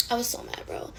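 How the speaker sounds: hushed confession; whisper. Real, not acted. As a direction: drop to a whisper. Whispered aside.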